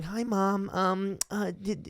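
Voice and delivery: put-on high, timid, polite child; polite kid voice. Boy calling his mom about lunch, meek and polite. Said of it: "They're going".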